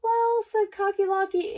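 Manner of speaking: high, loud rooster voice. Acted. Rooster answering the frightened birds, high and loud. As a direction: crow the word out proudly. Rooster begins his reply.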